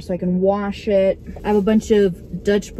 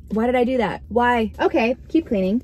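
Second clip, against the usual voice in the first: ordinary talk, nothing marked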